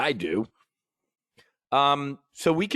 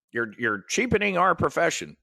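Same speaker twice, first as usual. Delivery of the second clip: higher-pitched mock whine of a rival; mocking complaint; 7 semitones higher than usual. Trader mimicking conference rivals who object to free briefs, mocking. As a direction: imitate an indignant colleague, pitched up.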